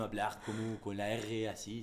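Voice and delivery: slow, exaggerated French-accented R; clichéd French R. Shown as a demonstration. Actor explaining the cliché, stock French accent. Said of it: "el cliché es".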